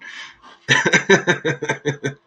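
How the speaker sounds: loud, high burst of laughter; hearty laugh. Real, not acted. Streamer cracking up at a chatter, laughing hard. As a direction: crack up at the teasing.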